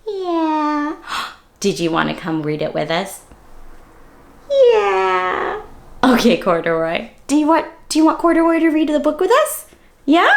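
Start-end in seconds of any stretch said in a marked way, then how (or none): 4.5-5.6 bear voice. Acted. Bear puppet answers.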